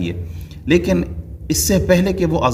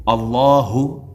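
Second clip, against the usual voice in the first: ordinary talk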